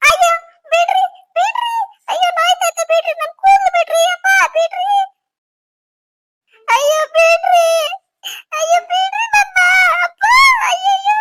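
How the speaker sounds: high, loud wailing pleas; screaming in pain. Acted. Wife begging husband to release her hair, screaming in pain. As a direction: cry out to be let go, shouting and wailing.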